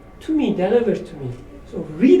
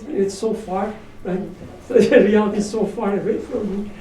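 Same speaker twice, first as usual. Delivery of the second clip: ordinary delivery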